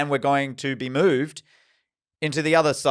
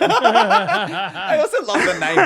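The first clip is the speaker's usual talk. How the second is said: high, slow, giggling delivery; laughing high delight; 5 dB louder, much slower than usual. Real, not acted. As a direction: say it through giggles. Laughing remark.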